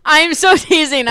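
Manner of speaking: loud, slow and playful; playful loud insistence; playful emphasis. Real, not acted. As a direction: stretch it out teasingly.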